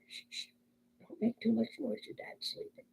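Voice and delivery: imitated parent shushing a child; barely audible, so as not to wake Dad; hushed whisper. Hushing a child as father sleeps.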